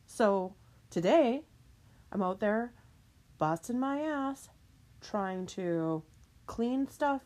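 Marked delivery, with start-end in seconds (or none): none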